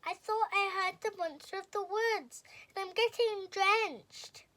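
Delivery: high, loud and frightened, pleading; a wet little rabbit begging to come in; anxious Rabbit. A narrator's reading. Rabbit begging Owl for shelter from the monster, high and scared.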